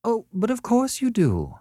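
very high, sugared insistence; sweetly. A narrator's reading. Annette corners Beverly about the critic's work, mock-sweetly. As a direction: coo it with barbed sweetness. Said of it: "she went on sweetly".

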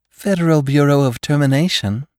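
sweet, gracious receptionist answering the phone; gracious society hostess. Acted. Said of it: "said the very warm voice of a hostess".

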